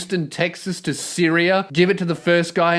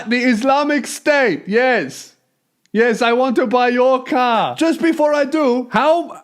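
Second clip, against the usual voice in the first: put-on raised, lightly accented caller voice, higher; mock ISIS buyer; 5 semitones higher than usual. ISIS buyer on the phone to Mark, mock higher accented voice. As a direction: play a bumbling jihadi phoning to buy the truck.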